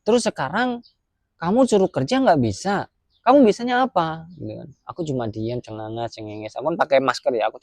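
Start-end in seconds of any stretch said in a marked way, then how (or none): 0.0-4.3 higher, sharper mock bark of the same question; retold scolding. Boss scolding worker again, higher mock angry. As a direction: ham up the boss's contempt. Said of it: "dibentak-bentak".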